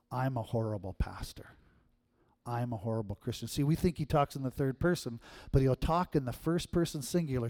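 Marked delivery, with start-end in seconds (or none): 0.1-3.5 soft, low self-accusation; inner voice. Acted. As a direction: murmur it as your own private thought. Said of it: "trying to sound and look exactly like you".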